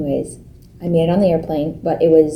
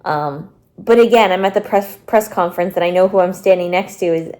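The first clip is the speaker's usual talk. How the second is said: normal talking voice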